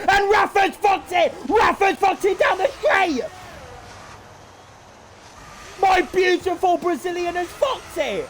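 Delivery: devastated commentator shout; anguished shout. Real, not acted. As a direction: scream as your driver crashes out. Favourite driver crashes at the end.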